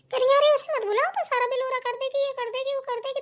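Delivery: high-pitched mimicry of an objecting relative; retold fussing, pitched well up; mimicked objection. Retelling another woman's objection to a helper.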